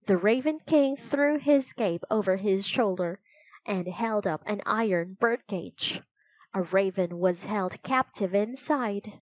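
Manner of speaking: plain reading voice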